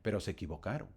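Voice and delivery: ordinary delivery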